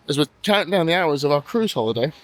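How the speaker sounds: normal talking voice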